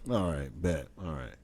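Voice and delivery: ordinary talk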